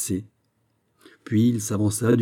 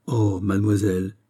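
usual speaking voice

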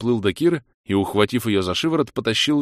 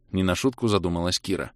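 ordinary delivery

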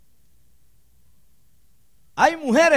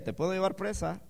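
smiling calm threat; 9 dB quieter than usual. Retold or imitated. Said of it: "Se lo decía sonriendo".

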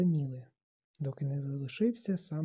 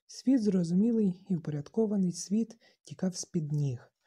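ordinary delivery, nothing marked

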